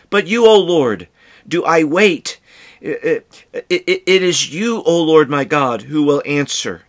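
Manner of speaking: heartfelt psalm read as prayer; fervent scripture reading. Acted. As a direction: cry out the psalm to the Lord. Reads scripture quote aloud.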